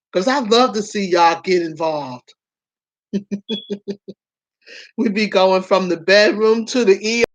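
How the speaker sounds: usual speaking voice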